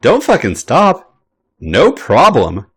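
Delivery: slow, amused teasing echo. A narrator's reading. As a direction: repeat his words back with mock innocence. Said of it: "Sammy replies, squeezing faster".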